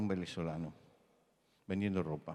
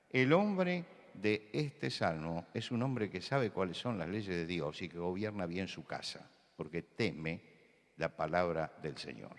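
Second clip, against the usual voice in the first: ordinary talk, nothing marked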